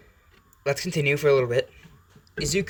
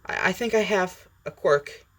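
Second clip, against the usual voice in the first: high-pitched, hesitant admission; sighing confession; 8 semitones higher than usual. Acted. Boy admits his quirk to his mom, hesitant and high. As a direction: stammer it out haltingly in a raised voice. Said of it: "he sighs and goes".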